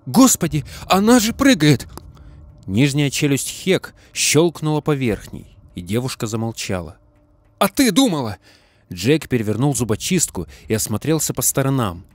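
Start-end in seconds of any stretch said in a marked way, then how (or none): 0.1-1.9 high, loud frightened cry; startled shout. Acted. Startled cry on jumping rat. 7.6-8.4 shouting. Acted. Retort shouted during race.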